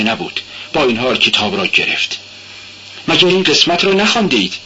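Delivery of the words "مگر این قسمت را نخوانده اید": a harsh, higher, fast question; man growing harsher with the girl. Acted. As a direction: bite the question out roughly. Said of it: "صدایش بیش از پیش خشن بود".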